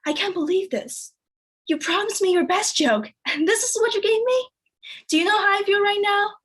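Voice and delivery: high-pitched, incredulous complaint; mock indignation. Disappointed lover after her failed joke, mock indignation. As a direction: imitate an aggrieved lover, pitch climbing.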